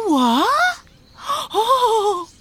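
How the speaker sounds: high, loud startled yelp; startled fisherman's shout; shocked shout. A narrator's reading. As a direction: cry out in shock, pitch leaping up. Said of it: "the fisherman shouted".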